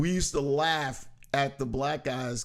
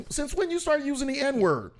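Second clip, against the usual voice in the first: incredulous quote; 10 semitones higher than usual. Retold or imitated. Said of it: "they'd be like".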